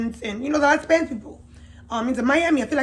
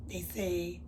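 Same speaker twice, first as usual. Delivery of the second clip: ordinary talk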